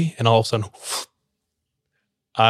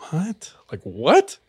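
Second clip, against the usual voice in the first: a high What, then a whispered Like, what; super skeptical; 6 semitones higher, slower than usual. Retold or imitated. Zion hearing about the monkey demon, whispering his disbelief. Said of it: "Super skeptical".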